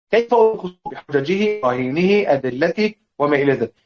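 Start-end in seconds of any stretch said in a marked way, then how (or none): none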